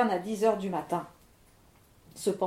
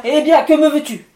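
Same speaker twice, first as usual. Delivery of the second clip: much louder, impatient spirit voice; gruff storm spirit; 5 semitones higher, 13 dB louder than usual. Acted. Levent asking the miller's wish, loud impatient spirit. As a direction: bark the question.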